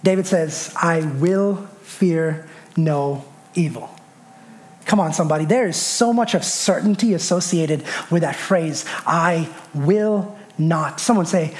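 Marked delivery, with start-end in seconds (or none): 0.8-3.9 low and deliberate, one word at a time; slow declaration. Retold or imitated. Preacher quoting David on fear, slow low declaration. 9.0-11.0 slow, certain declaration. Shown as a demonstration. Preacher showing the certainty in the phrase, slow word-by-word delivery.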